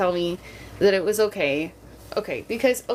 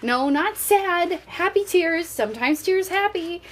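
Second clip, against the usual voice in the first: put-on soothing a toddler; 9 semitones higher than usual. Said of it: "And I said".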